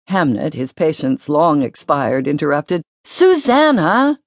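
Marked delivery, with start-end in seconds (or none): 3.0-4.2 high, drawn-out whiny call of her name; a little brother calling out, fed up; boy interrupting to drag his sister away; impatient call. A narrator's reading.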